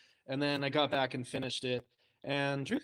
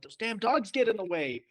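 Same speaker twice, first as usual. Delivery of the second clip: playful grumble, higher pitched; mock exasperation; 8 semitones higher, 4 dB louder than usual. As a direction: complain in jest about beloved dogs. Playful grumbling about beloved dogs.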